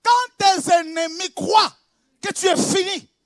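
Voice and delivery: loud, slow, high preaching shout; fiery preaching. Acted. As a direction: hammer each phrase to the crowd.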